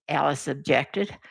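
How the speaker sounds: ordinary delivery, nothing marked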